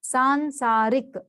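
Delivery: सांसारिक slow, in separate parts; syllable by syllable. Shown as a demonstration. Teacher showing spelling by sound, सांसारिक slowly drawn out. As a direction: stretch सांसारिक as सां-सा-रिक. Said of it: "जैसे आप बोलो वैसे लिखना है".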